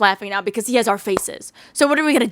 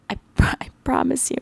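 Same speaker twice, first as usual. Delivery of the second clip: a hushed, confiding whisper; whispered promise; 3 semitones higher, 4 dB quieter than usual. Real, not acted. Repeated promise, now whispered.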